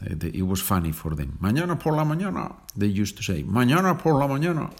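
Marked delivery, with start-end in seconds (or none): 1.4-2.6 imitating an American accent, pitched much higher and fast; mock American student mangling the Spanish, quick and high; playful American accent on mañana por la mañana. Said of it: "With the American accent". 3.4-4.7 put-on laughing through a high, fast American accent; American student imitation, giggling and quick; hammed-up American accent, laughing. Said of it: "With the American accent".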